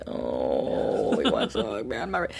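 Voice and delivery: low soft timid worry; shy child's voice. Retold or imitated. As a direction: hold back like a shy little girl. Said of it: "And she'd be like".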